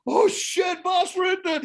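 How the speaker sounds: retold panicked shout of the driver; startled shout. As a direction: yelp it out as if caught. Driver recognizes him, panics.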